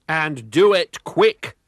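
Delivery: slow, loud warning; warned. A narrator's reading. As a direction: lean on him with a threat. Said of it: "warned McSneak".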